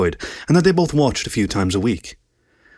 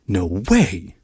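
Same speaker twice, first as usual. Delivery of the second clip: a touch of stunned disbelief; lightly voiced astonishment; 3 semitones lower than usual. A narrator's reading. Inner disbelief.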